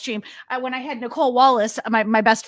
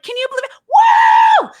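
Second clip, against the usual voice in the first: high-pitched disbelief into screaming whoop; crazy-person elation; 9 dB louder than usual. Retold or imitated. Retelling disbelief to partner after the win, crazed elation. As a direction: burst from giddy question into loud whoop. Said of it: "I was, like, a crazy person".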